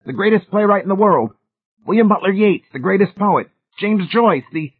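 high, loud, frantic listing; fearful howl. A narrator's reading. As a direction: howl out names in alarm until cut off. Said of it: "McFaith howled fearfully".

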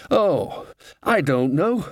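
high-pitched cry at moderate volume; restrained scream. A narrator's reading. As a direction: call it out high, without full force. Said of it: "screamed the dean".

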